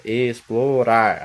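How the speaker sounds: "explorar" drawn out slowly, each syllable apart, last syllable strongest; slow stress demo. Shown as a demonstration. Teacher showing students the strong syllable, slow and deliberate. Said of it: "a mais forte".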